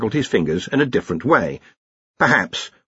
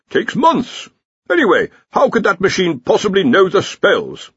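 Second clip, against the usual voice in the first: put-on higher, scoffing old wizard; scoffing Dean; 8 semitones higher, 3 dB louder than usual. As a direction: brush off the young upstart's claim. Dean dismissing the project.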